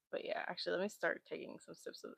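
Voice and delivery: soft, breathy murmur; hushed. Real, not acted. About to sip tea, dropping to a hushed murmur. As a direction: drop to a near whisper. Quiet before sipping tea.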